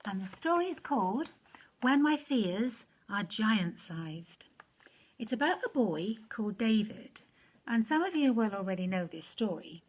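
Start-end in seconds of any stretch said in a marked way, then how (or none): none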